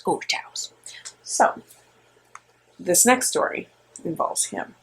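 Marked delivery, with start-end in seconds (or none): 0.0-0.7 spooky whisper. Pretend. Spooky echo of brother's words.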